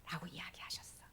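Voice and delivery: soft hushed telling; hushed storyteller voice; whispered narration. A narrator's reading. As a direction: whisper it to the kids.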